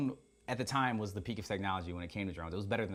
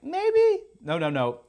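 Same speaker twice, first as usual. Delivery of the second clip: much higher, louder, a cheeky machine saying no; squeaky mock drone voice; 12 semitones higher, 10 dB louder, much slower than usual. Vlogger voicing his faulty drone refusing to fly, squeaky and high. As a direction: play the glitchy drone as a stubborn little creature.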